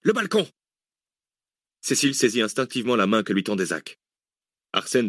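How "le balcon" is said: very high, quick command; urgent order. A narrator's reading. Zach sends his companions to the balcony, urgent command. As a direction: call out the escape route urgently. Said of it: "ordonna à nouveau Zach".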